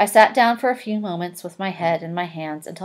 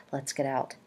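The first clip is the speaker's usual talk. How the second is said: softer, low murmur to a friend; whispered; 4 semitones lower, 9 dB quieter than usual. A narrator's reading. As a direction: say it under your breath to leave quietly. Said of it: "he whispered".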